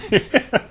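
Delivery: high short laugh; real laugh. Real, not acted. Amused by the insulting tweet, a quick laugh. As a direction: burst out laughing.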